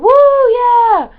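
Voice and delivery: very loud, high whoop; imitated cheering. Mimicking the people beside them at a gig, loud high cheer. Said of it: "and they were going".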